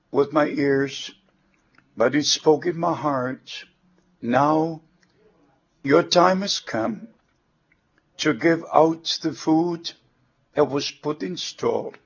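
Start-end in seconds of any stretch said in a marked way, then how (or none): none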